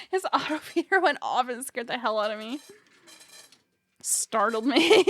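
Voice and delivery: loud and shaken, just startled. Real, not acted. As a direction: blurt it out after a scare.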